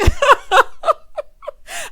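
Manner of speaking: high, loud burst of laughter; shrieking laugh. Real, not acted. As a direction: cackle out loud at the memory. Laughing at [name]'s Versus loss.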